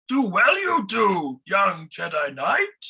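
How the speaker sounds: slow, wise little Jedi master, words inverted; Yoda-like voice. Acted. Host plays a wise old Jedi master, slow and odd. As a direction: croak it out like an old swamp sage.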